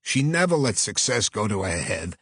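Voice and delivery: persuasive Brooklyn agent; Brooklyn accent. Acted. Agent offering fee and praising late client, earnest Brooklyn accent. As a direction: make a heartfelt pitch in Brooklyn tones. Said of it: "a voice with a strong Brooklyn accent".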